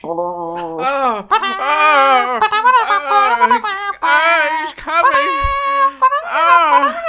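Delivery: hammed-up high, loud screams; mock agonised wails. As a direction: cry out as if fighting a takeover. Villain snaps out of brainwashing.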